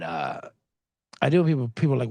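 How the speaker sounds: ordinary delivery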